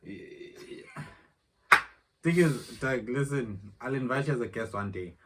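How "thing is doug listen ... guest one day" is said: raised voice to roommate. Real, not acted. Talking over to Doug mid-vlog.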